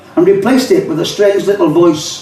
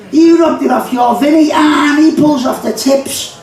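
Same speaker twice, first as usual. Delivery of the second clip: put-on much higher, fussy young Scouse lad; second young Scouser; 10 semitones higher than usual. Poet voicing a second lad refusing the soggy end, much higher. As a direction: squeal it out like a fussy kid. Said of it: "This is called the kidder conversation".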